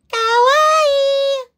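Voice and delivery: very high, slow squeal of kawaii; cute Japanese fan. Retold or imitated. Mimicking Japanese fans praising her nails, high squeal. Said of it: "Sinasabihan nila ako".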